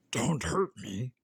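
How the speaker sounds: slow, a touch of a scared whimper; a big lion begging like a child; whimpered plea. Acted. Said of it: "he whimpered".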